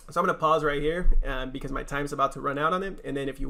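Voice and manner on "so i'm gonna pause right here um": plain reading voice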